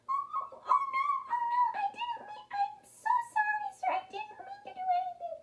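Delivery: tiny, very high squeaky mouse voice; frightened mouse. A narrator's reading. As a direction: squeak out a panicked apology. Mouse pleads with angry lion.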